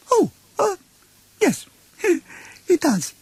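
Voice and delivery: very high, nervous little pig laugh; flustered Piglet. Acted. Piglet agrees with Pooh, high and flustered. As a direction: bluff it like a piglet hiding fear.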